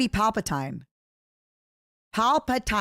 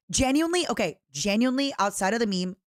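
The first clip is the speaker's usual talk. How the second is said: ordinary delivery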